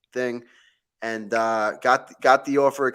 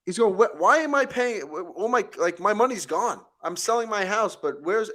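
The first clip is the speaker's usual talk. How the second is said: indignant complaint; 9 semitones higher, 5 dB louder than usual. Retold or imitated. John complaining at closing, retold.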